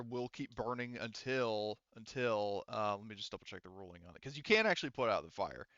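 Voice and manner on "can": "can" leaned on and pitched up; stress on can. Real, not acted. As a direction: stress "can" so it isn't heard as cannot. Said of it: "I said can, not cannot".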